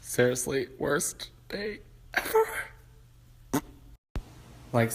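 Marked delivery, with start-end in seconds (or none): 0.8-2.4 whispered, slow mock sob; breathy fake crying; whimpering whisper. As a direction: whimper it out pitifully.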